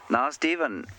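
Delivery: high, lilting go at a Welsh accent; badly done Welsh accent with swooping pitch; attempted Welsh accent. Shown as a demonstration. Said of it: "Welsh accent that I can muster".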